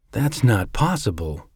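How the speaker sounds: plain reading voice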